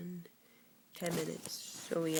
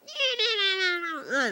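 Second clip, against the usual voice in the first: goat voice; 5 dB louder than usual. Retold or imitated. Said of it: "They're like".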